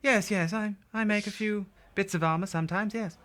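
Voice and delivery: put-on high, genteel old shopkeeper voice. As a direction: play the polite old shopkeeper sipping tea.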